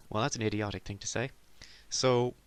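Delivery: ordinary talk, nothing marked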